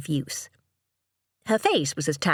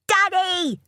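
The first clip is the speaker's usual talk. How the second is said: put-on high-pitched little boy calling out; excited child's cry; 4 dB louder than usual. A narrator's reading. Son spotting his father's car, excited cry. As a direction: cry out like a delighted small boy. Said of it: "cried Oscar".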